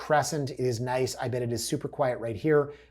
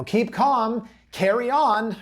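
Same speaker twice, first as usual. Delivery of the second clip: high, loud mock-cheery slogan; pitched-up mockery of a motto; mocking sign slogans; 9 semitones higher, 7 dB louder, slower than usual. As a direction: belt it out with fake enthusiasm. Mocking staging signs' slogans.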